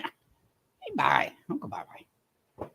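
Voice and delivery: whispered playful goodbye; mock whisper. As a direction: breathe it out, teasing a friend who left. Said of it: "I went".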